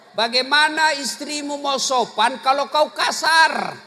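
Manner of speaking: loud, rising sermon shout; fiery preaching. Acted. Preacher scolding rough husbands, loud and shouting. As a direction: hammer it home to the men.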